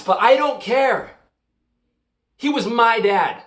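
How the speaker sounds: loud, high, drawn-out protest; defiant outburst. Acted. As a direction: snap back slowly and forcefully, stressing each word. Defensive outburst about mourning dad.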